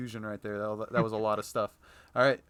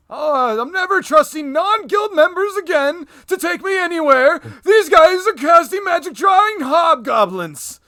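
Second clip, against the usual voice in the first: put-on angry old man; 14 dB louder, slower than usual. Old man complains loudly.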